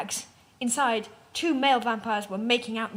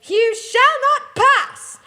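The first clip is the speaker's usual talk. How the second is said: much higher, much louder, slow hammed-up roar; booming pathetic shout; 13 semitones higher, 10 dB louder, much slower than usual. A narrator's reading. Bully blocking the gates, slow hammed-up booming shout. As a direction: bellow it like a wizard blocking a bridge. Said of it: "the bitch laughed pathetically".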